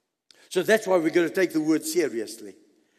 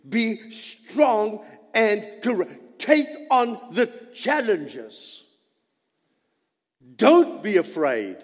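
emphatic, driving sermon delivery; rally the congregation with punched phrases; forceful preaching; 5 semitones higher, 5 dB louder than usual. Real, not acted. Preacher urging brothers and sisters to be strong, forceful preaching.

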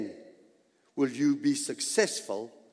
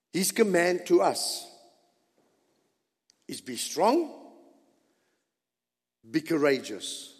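ordinary talk

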